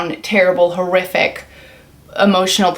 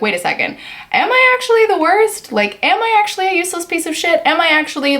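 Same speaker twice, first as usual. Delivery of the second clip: incredulous self-questioning; 9 semitones higher than usual. Retold or imitated. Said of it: "check ourselves and be like".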